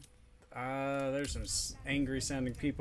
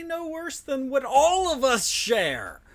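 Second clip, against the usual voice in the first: high, loud and slow heroic line; hammy character voice; 12 semitones higher, 13 dB louder, slower than usual. Acted. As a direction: ham it up like a stage hero. Roleplaying reply to companion.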